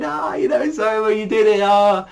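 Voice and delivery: high, teary-sounding mock gush; squeaky overjoyed praise for Soma; a lightly hammed-up grateful friend; emotional friends. Dorm friends emotional over Soma.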